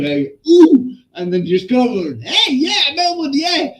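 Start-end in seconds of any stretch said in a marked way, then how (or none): 0.5-0.9 sudden high, loud "ooh"; pained "ooh". Retold or imitated. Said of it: "even he went". 2.2-3.7 high-pitched whoop of a retold cheer; mimicked cheering. Imitating friend cheering after head bang.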